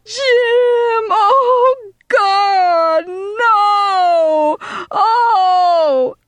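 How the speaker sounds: high, wailing scream, each word stretched. A narrator's reading. Girl screaming over the dead boy, long anguished wail. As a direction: wail it out in horror at full pitch. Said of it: "Susie screamed".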